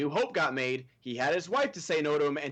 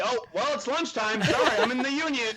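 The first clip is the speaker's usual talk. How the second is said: higher, louder laughing excuse; mock excuse; 10 semitones higher, 5 dB louder than usual. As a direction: toss off a silly excuse, laughing. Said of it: "it's like".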